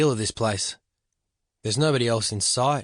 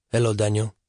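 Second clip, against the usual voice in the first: put-on thick Argentinian accent. A narrator's reading. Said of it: "he says in his thick Argentinian accent".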